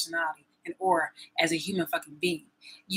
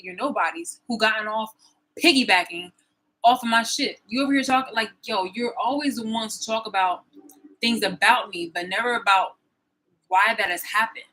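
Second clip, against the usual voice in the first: ordinary talk, nothing marked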